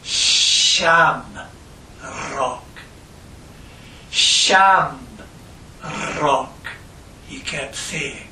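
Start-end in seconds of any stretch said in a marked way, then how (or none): 0.0-6.7 put-on slurred drunk voice. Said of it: "with a slurred voice".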